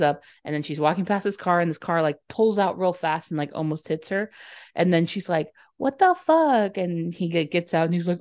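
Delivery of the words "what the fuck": high, loud indignant exclamation; mock outrage. Girl nearly hit by a car, mock outrage. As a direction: act out startled indignation, pitched way up.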